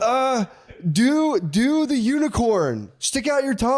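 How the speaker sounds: put-on very high, halting child's voice; high-pitched kiddie commands; demanding little kid. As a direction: mimic a kid slowly dictating the next trick. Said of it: "They FaceTime you".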